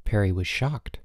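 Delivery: usual speaking voice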